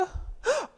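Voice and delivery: high excited gasp; delighted gasp. Acted. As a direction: gasp at what you spot. Spotting item in picture.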